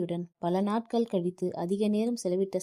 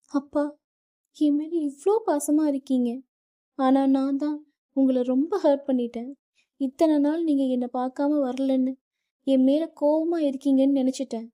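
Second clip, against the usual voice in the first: higher, louder sobbing confession; crying; 7 semitones higher, 5 dB louder than usual. A narrator's reading. Daughter clinging to father, sobbing her regret. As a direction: break down in tears of guilt. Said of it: "அழுகையை அடக்க முடியவில்லை".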